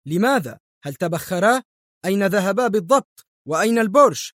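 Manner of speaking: agitated demand for answers; exclaiming. A narrator's reading. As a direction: cry out the questions, climbing in pitch. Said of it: "هتف مايكل".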